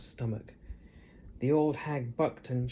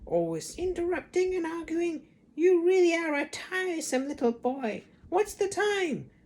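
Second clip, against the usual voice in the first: put-on high old hag voice, slowing at the end; scolding Grandma; 3 dB louder than usual. A narrator's reading. Grandma nags George and demands the time. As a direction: nag like a mean grandmother.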